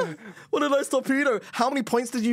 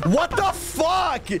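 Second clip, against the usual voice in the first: high, drawn-out exclamation of disbelief; outraged cry; 6 semitones higher, much slower than usual. Real, not acted. Player to rival after a bad turn, outraged cry.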